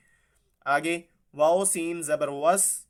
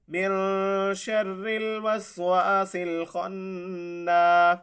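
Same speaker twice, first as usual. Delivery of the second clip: slow melodic tajweed chant; Quran recitation; 3 semitones higher, much slower than usual. Shown as a demonstration. Teacher modelling the word for students, slow recitation. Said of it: "recites Quran word slowly".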